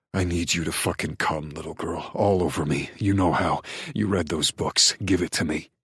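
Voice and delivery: low, commanding male lover's voice; inflexible order. A narrator's reading. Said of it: "His words are an inflexible order".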